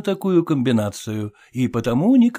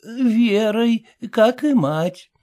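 put-on high, slow elderly lady voice; a frail old woman explaining patiently; lightly aged lady, unhurried; 7 semitones higher, much slower than usual. A narrator's reading.

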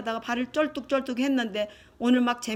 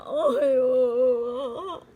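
long drawn-out sob into a call for big brother; mock wailing; much slower than usual. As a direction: wail as if missing your brother badly. Acting separated brother crying out. (길게 통곡하듯 형을 부르기)

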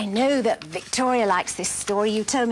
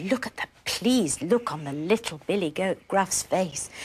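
plain reading voice

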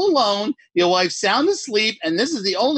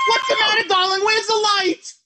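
high, alarmed questions; husband fears for his wife; frightened; 14 semitones higher than usual. Acted. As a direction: panic in the dark. Panicked husband in dark room.

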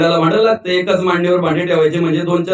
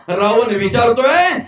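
ordinary talk

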